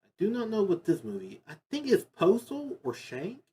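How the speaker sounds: ordinary talk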